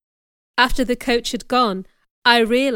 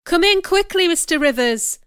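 much higher, loud urgent call; crying out; 8 semitones higher, 6 dB louder than usual. A narrator's reading. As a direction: call him in from the storm. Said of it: "the old lady cried".

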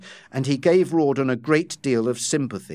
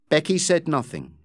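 ordinary delivery, nothing marked

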